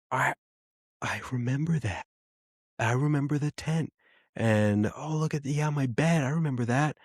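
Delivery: small, high, wondering and emotional; emotional little daughter. Retold or imitated. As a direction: imitate a girl overcome by a memory. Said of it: "starts getting super emotional with it".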